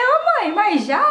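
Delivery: high, loud surprised "Ô mãe, mas já?"; mock-shocked teasing of a crying mother; a daughter surprised her mother already cries; surprised protest. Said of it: "Eu falei".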